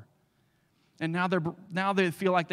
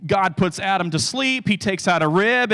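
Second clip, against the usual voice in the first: ordinary delivery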